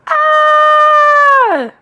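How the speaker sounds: very high, loud, held scream; startled yell. A narrator's reading. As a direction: scream long in fright. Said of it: "His father yelled".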